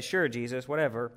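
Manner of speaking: low, soft brush-off; dismissive. Retold or imitated. Doubting believers answering Jesus's promise, dismissive. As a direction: humour him with no belief at all.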